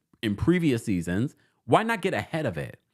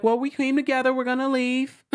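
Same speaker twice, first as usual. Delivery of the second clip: mock high reply; 11 semitones higher than usual. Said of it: "And they're like".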